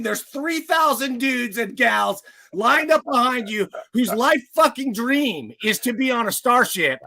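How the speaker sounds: furious recruiter yelling; angry shout. Pretend. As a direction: tear into the applicant, loud. Mock interviewer berating a candidate.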